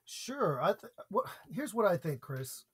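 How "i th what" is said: ordinary delivery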